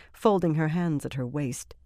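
ordinary delivery, nothing marked

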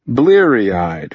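usual speaking voice

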